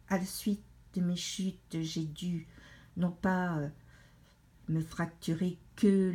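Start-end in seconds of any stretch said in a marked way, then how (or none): none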